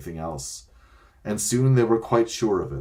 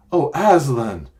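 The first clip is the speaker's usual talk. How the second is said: put-on a louder anguished cry of a child's name; despairing cry; 6 semitones higher, 8 dB louder than usual. A narrator's reading. Children learning they can never return, despairing. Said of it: "in despairing voices".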